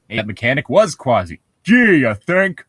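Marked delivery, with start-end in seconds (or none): 1.6-2.6 sarcastic. Real, not acted. Sarcastic retort to own reading.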